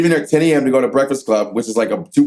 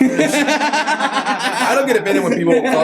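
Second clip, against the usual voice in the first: laughing; 8 semitones higher, slower than usual. Real, not acted. Laughing while telling.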